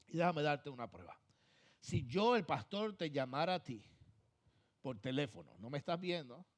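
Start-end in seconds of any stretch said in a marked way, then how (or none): none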